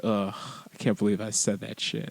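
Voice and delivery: low, soft self-disgusted aside; embarrassed muttering. Acted. Dad recalling his old pickup lines, low cringing mutter. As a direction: cringe at yourself under your breath.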